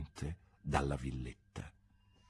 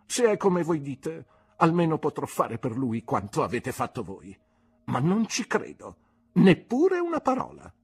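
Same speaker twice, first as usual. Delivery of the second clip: aunt's scornful defiance; 7 semitones higher, 5 dB louder than usual. Acted. Aunt dismisses Mr Marston.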